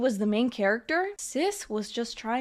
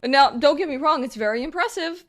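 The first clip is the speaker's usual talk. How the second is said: ordinary talk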